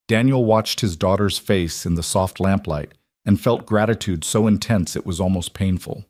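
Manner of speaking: ordinary delivery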